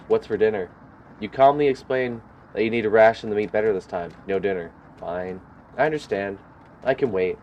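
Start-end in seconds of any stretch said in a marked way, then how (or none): none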